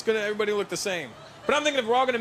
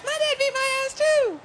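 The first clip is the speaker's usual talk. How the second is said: put-on much higher, slow childlike boast; kid bragging; slower than usual. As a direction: play a proud little kid. Kids bragging at playground.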